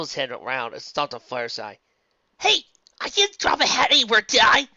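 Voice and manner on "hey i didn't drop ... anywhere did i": loud. Acted. Luffy voiced, loud startled.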